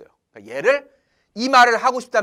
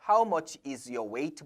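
wrong version, slowly; 6 semitones lower, 6 dB quieter, much slower than usual. Shown as a demonstration. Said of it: "보다는".

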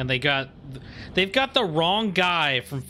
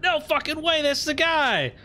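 much higher, thrilled cry; excited disbelief; 12 semitones higher than usual. Retold or imitated. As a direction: blurt it out, delighted by the news. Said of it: "I was like".

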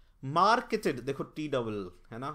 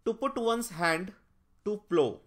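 slow reading aloud; 5 semitones higher, 4 dB louder, much slower than usual. Shown as a demonstration. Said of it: "reading an option".

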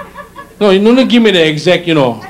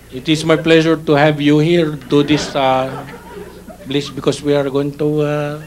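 put-on slow Filipino accent. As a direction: plod through it in a Filipino accent. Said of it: "I give you the slow way".

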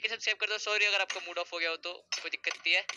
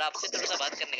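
ordinary talk

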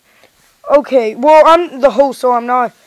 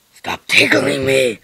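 much lower, slow, gruff-sounding protest; deep toy voice. Pretend. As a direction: growl it out like a big beard. Toy character being tickled.

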